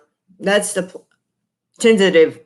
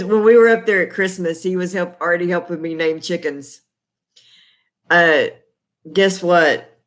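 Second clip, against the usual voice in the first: ordinary talk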